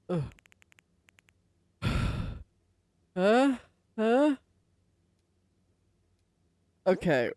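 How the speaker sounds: ordinary delivery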